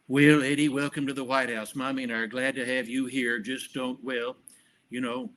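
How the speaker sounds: a light take on Ronald Reagan, grandfatherly; Reagan impression. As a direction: imitate Reagan welcoming a guest. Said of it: "That's a really good Reagan".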